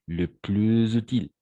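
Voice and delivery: "Le plus utile" with the s of "plus" linked as z onto "utile"; teacher modelling "plus utile" as "plu-zutile"; liaison in plus utile. Shown as a demonstration. Teacher showing students the liaison, linking clearly. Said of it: "on va faire de la même façon une sorte de liaison".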